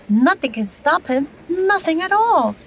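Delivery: ordinary delivery, nothing marked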